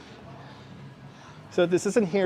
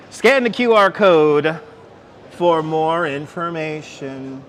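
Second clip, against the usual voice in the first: "Scan the Q R code" read slow and louder, like a public notice; mock sign announcement; 5 dB louder, slower than usual. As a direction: boom it out like a PA announcement. Reading the sign aloud, then repeating.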